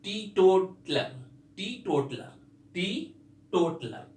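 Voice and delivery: टीटोटलर drawn out slowly, syllable by syllable; slow pronunciation drill. Shown as a demonstration. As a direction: stretch टीटोटलर out, slowly, each syllable clear. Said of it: "क्या pronunciation है?".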